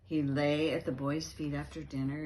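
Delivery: usual speaking voice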